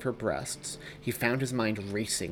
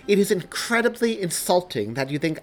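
much louder, much higher furious rant; shaking with rage; 9 semitones higher, 9 dB louder than usual. Acted. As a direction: let the rage shake every word. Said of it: "shaking with rage".

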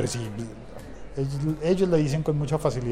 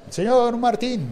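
drawn-out, higher call; stretched-out "señor", pitched up; calling out to someone; 10 semitones higher, 5 dB louder, much slower than usual. Retold or imitated. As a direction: hail him slowly and loudly, voice raised high. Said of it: "dejé razón con el vigilante, dije".

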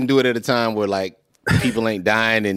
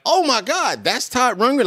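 much higher, re-enacted amazement; excited recognition; 13 semitones higher than usual. Telling a friend about discovering an artist, excited recognition. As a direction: recreate the jolt of sudden discovery. Reenacts recognizing a familiar song.

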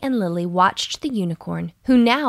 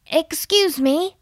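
slow, higher call up to a hawk; polite call; 8 semitones higher than usual. A narrator's reading. Buffy calls up to the hawk in the tree. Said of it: "called Buffy".

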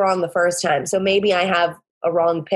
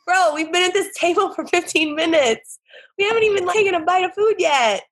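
higher, incredulous sass; mock scolding a bro; exasperated; 9 semitones higher than usual. Woman mocks her rude date with exasperated sass.

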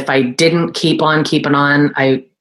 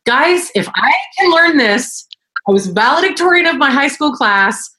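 voicing her past self; 8 semitones higher than usual. Mom answering the kids.

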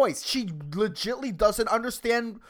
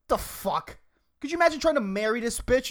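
ordinary talk

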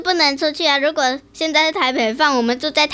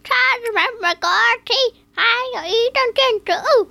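parrot toy voice; 9 semitones higher, faster than usual. Acted. Parrot toy repeating fast and high.

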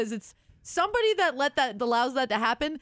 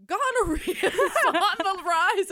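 laughing read; 8 semitones higher, 4 dB louder, slower than usual. Retold or imitated. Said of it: "and it was like".

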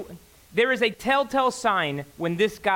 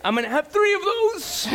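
high, wailing mock sob of a dad; hammed-up tearful dad, much higher; mock crying; 9 semitones higher than usual. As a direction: wail it like a weepy father, slow on the last words. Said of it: "I'm like".

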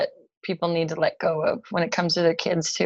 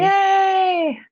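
high, drawn-out happy cheer; delighted little hooray; 11 semitones higher, 4 dB louder than usual. Real, not acted. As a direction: celebrate the thanks with a long, high yay. Cheering at being thanked.

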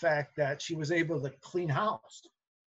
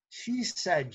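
ordinary delivery

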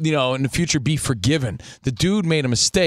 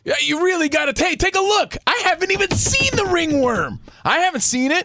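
higher, hammed-up spokesman calling for attention; mock press conference; 11 semitones higher than usual. Host acting out the league's press conference, hammed-up and higher. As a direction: play a baseball official clearing a star's name.

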